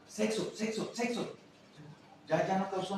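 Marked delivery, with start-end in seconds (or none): 0.1-1.3 repeated higher. Shown as a demonstration. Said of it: "lo repito más".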